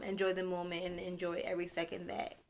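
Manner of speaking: usual speaking voice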